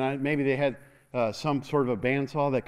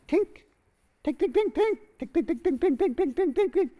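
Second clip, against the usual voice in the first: rapid high tinking chisel taps; high chisel tinks; 14 semitones higher than usual. Retold or imitated. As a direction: tap fast like many chisels in a quarry. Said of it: "in the quarry going".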